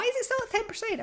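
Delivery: indignant at the battery; surprised disbelief. Real, not acted. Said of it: "Ten percent still".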